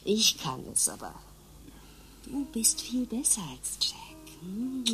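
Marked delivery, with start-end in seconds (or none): 2.2-4.8 slow intimate whisper. Acted. Lover whispering praise in bed, a slow whisper. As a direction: breathe it close to his ear.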